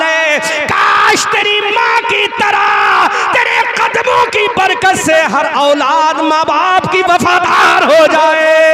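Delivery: high, loud, slow preached cry; shouted sermon climax. Acted. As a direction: build to a shouted blessing. Mother's wish, shouted climax. (ऊँची, धीमी, गरजती दुआ)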